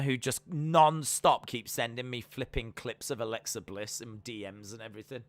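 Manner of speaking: ordinary talk, nothing marked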